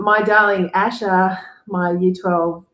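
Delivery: normal talking voice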